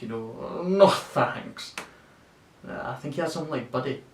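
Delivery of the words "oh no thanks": drawn-out, higher, louder smug refusal; wincing, smug. Acted. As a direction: wince through a smug brush-off. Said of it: "like fucking wincing and smug".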